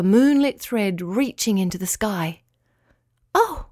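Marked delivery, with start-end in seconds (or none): 3.3-3.7 high-pitched startled "oh"; startled cry. A narrator's reading. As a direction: jump in pitch, caught by surprise. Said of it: "cried Winky".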